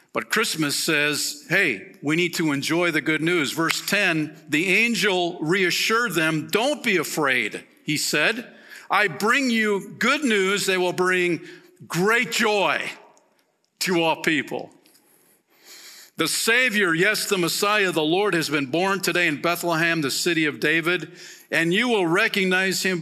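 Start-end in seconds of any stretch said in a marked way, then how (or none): 1.5-8.5 high, loud, urgent preaching voice; impassioned sermon climax; preaching at full force. Acted. Preaching the Christmas story. 8.9-21.1 high, loud, proclaiming the angel's news; full-voiced pulpit proclamation; fervent preaching.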